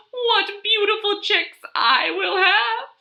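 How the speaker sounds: high, dreamy boastful hen; vain hen. Acted. Zinnia admiring herself in the hay, high vain hen. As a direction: swoon over your future chicks.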